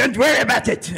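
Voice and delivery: high, croaking sinister villain impression; the Emperor. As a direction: taunt them as an evil overlord. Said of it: "I start imitating the Emperor from Star Wars".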